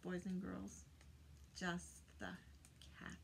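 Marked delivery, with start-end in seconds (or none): none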